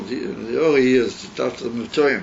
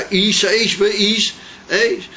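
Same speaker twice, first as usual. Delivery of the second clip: Eish Eish Be'Eish Eish stretched slow and higher; drawn-out intoning; 8 semitones higher, 3 dB louder, much slower than usual. Shown as a demonstration. Teacher lingering over the holy phrase, slow and raised.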